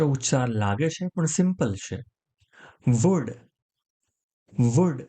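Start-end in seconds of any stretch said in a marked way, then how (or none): 2.9-3.3 "Would" with no L sound, ending straight on D; silent L. Shown as a demonstration. Teacher showing learners "Would" without its L, silent-L pronunciation. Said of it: "આમાં એલ નો ઉચ્ચાર નથી કરવાનો". 4.8-5.0 "Would" with no L sound, ending straight on D; silent L. Shown as a demonstration. Teacher showing learners "Would" without its L, silent-L pronunciation. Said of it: "આમાં એલ નો ઉચ્ચાર નથી કરવાનો".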